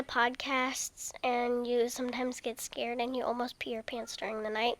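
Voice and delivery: teasing hushed whisper. Real, not acted. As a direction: whisper it like a secret. Teasing secret to mom.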